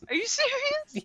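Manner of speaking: high, disbelieving squeal; incredulous squeak. Real, not acted. Disbelief at friend's book.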